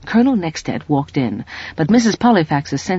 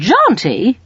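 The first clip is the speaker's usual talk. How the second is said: high, louder startled Jaunty?; Jaunty? tossed back, amused and surprised; amused exclamation; 9 semitones higher, 4 dB louder than usual. A narrator's reading. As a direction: exclaim it, half smiling. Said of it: "exclaimed Missus Polyfax".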